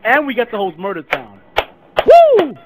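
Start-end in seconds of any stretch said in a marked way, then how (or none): 2.1-2.5 high loud hype whoop; excited whoop. Real, not acted. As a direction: whoop in delight. Hyped about hosting Murder Town.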